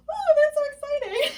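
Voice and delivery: high, loud thrilled squeal; excited. Real, not acted. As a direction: squeal with delight. Sees guy wearing her mask.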